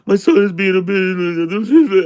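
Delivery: high, loud wail smearing into gibberish; mock a weepy waitress babbling. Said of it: "She was just babbling her shit".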